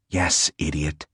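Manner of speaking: a tiny voice inside Savin's head answers, low and soft; voiceless inner voice. A narrator's reading. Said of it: "voicelessly saying".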